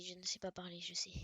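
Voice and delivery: whispered self-mockery; sheepish whisper; whispered aside. Real, not acted. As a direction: drop to a whisper to mock yourself.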